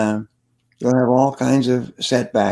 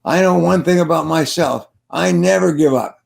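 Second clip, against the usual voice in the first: put-on raised, emphatic boyish declaration; said really loudly; 4 semitones higher, 4 dB louder than usual. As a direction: proclaim it loudly, as a proud fact. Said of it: "he said really loudly".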